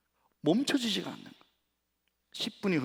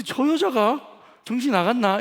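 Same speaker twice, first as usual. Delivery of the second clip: high, loud indignant outburst; 9 semitones higher, 6 dB louder than usual. Retold or imitated. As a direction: scoff as if she's lost her mind. Pastor retells angry thought. (높고 큰 소리로 어이없어하며)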